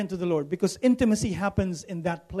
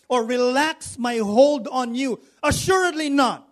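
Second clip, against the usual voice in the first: rising louder and higher to a cry on helpless; building preacher's cry; 4 semitones higher, 5 dB louder than usual. Acted. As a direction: preach it harder with each line, peaking on helpless. Preacher building God's promise.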